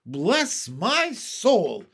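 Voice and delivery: high, loud, very drawn-out exclamation; astonished cry. A narrator's reading. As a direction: stretch each word in amazement. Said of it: "cried the head of the fire department".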